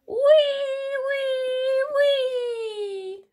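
very high, loud squealing wee; whistling snore. A narrator's reading. Narrator imitates Big Mouse snoring, high drawn-out whistle. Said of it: "indeed he heard".

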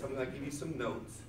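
normal talking voice

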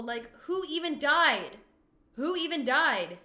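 loud, high, drawn-out disbelief; loud incredulous complaint; exasperated outcry. Pretend. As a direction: wail the question in exasperation. Exasperated at crying game character.

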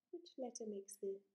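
normal talking voice

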